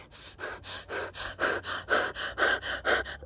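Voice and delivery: ragged panicked breathing, imitating hyperventilation; reenacting a panic attack with fast shaky breaths; hyperventilating breaths. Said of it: "I started being like".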